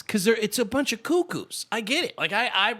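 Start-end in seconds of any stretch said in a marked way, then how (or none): none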